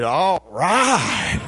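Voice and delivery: drawn-out parrot-like mimicry, bursting on the second word; mimicking pet bird. Pet macaw copies his owner coming in from the heat, bird mimicry. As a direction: squawk it like a talking bird. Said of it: "Davy said".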